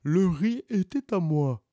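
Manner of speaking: mild stubborn tortoise; lightly voiced tortoise. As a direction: insist on your claim. Tortoise argues in court.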